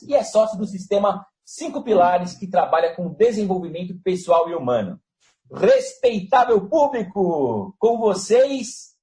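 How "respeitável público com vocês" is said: slow, rising circus announcer call; ringmaster. Acted. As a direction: build it up like a ringmaster.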